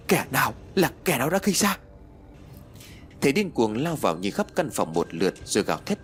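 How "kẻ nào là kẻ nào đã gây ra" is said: higher, a narrator's acted yell; acted shout. The master shouting at the room over the corpse. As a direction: cry out who did this. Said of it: "Thầy liền hét lớn".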